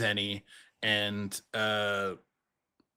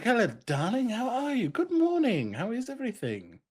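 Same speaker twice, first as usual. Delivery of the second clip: very high, gushing fake-friendly hello; put-on greeting voice; 14 semitones higher than usual. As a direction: swoop up high to charm a stranger. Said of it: "be like".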